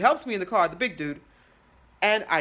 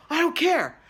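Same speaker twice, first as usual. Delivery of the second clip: exasperated dismissal; 8 semitones higher, 7 dB louder than usual. Acted. Snapping at billing question.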